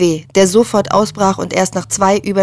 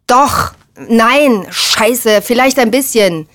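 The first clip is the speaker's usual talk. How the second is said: agitated, raised voice, contradicting oneself; heated, cornered back-pedalling; flustered admission; 3 semitones higher, 4 dB louder, slower than usual. A narrator's reading. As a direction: stumble into a reluctant confession. Flustered admission, later aped mockingly.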